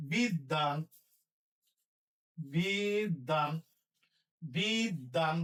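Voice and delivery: বিদ্যান drawn out slowly; slow dictation. Shown as a demonstration. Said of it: "দ এর সাথে বফলা".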